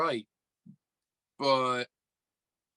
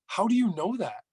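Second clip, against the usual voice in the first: higher-pitched, demanding question; acted-out suspicion, pitched up; retold incredulous challenge; 7 semitones higher than usual. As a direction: replay challenging her in disbelief. Retelling his suspicious challenge to her.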